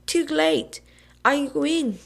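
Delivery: high gleeful shout through a mouthful; yelled while chewing. A narrator's reading. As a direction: crow it out mid-chew. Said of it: "Billy yelled, still chewing".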